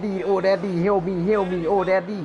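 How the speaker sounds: high, loud mock panic, begging daddy for help; hammed-up cry for daddy. Gamer mocking a scared kid, hammed-up panicked cry for help. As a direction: play a terrified kid screaming for his dad.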